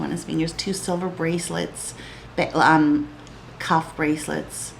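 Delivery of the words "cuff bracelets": ordinary talk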